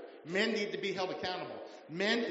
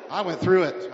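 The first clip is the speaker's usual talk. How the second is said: I went through it, punched out much louder; much louder repeat; 11 dB louder than usual. Real, not acted. Pastor insisting to wife and church, much louder repeat. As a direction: hammer the line home the second time. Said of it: "I'm telling you".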